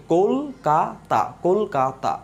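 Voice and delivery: "Kolkata" slowly as কোল, কা, তা; syllable by syllable. Shown as a demonstration. Teacher showing how we pronounce it, syllable by syllable. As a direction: separate the three beats of "Kolkata". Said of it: "আমরা উচ্চারণ করছি কিভাবে".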